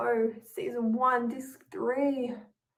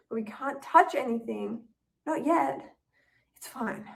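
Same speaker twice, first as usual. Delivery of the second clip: ordinary delivery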